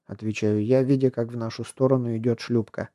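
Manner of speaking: ordinary delivery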